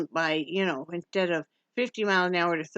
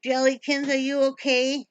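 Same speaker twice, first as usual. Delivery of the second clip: pet talk; 7 semitones higher, 5 dB louder than usual. Real, not acted. Cooing to second pet.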